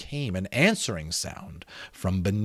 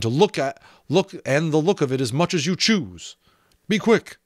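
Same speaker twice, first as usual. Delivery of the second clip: raised, pressing threats, sharp at the end; a magician losing patience with a skull; threatening magician; 7 semitones higher, 5 dB louder than usual. Acted. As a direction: push him hard, end with a snapped order.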